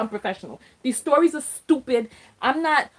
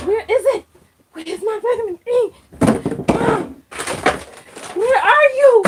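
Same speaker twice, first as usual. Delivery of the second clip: high, rising calls to a lost item; hammed-up desperate calling; frantic mock search; 11 semitones higher, 6 dB louder than usual. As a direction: call out as if it could answer.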